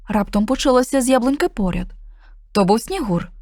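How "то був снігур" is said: usual speaking voice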